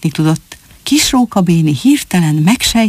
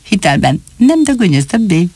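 fast, cheeky gabble with a grin; grinning gabble; 3 semitones higher than usual. Acted. Fox cub cheekily reports to his father, fast grinning gabble. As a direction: rattle it off grinning. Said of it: "vigyorogva hadalta".

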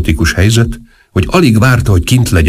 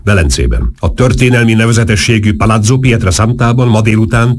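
even, raised-pitch dictation; steady telegram-style delivery on a higher note; dictating voice; 5 semitones higher than usual. A narrator's reading. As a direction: dictate an urgent press dispatch, quickening. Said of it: "diktáló hangon folytatta".

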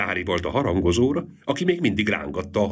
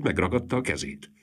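ordinary delivery

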